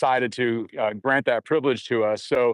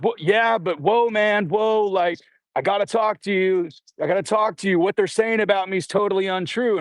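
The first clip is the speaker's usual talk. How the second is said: higher, hurried, eager; flustered excited protest; 7 semitones higher than usual. Retold or imitated. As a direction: plead eagerly to be heard. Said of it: "And he just goes".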